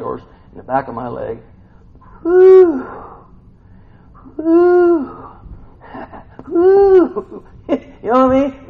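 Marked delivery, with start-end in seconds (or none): 2.2-2.9 high, loud, drawn-out woo; hammed-up ooh of pain; mock pain whoop. Acting out charley horse. 4.4-5.3 high, loud, drawn-out woo; hammed-up ooh of pain; mock pain whoop. Acting out charley horse. 6.5-7.4 high woo breaking into laughter; hammed-up laughing holler; laughing pain whoop. Acting out cramp while laughing.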